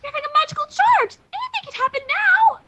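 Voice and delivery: high, urgent alarmed voice. Acted. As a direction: sound startled and urgent. Rarity alarmed by magic.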